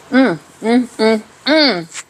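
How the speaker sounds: loud smacking, tasting hums; lip smacking. Real, not acted. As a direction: smack lips while humming with pleasure. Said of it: "I hope I'm not smacking in your ear".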